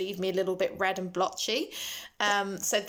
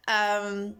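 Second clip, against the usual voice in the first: ordinary talk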